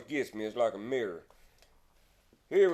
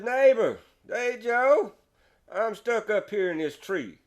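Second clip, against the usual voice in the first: higher, louder call down from a tree; calling neighbor; 7 semitones higher, 3 dB louder than usual. Acted. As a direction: shout down for help from up high.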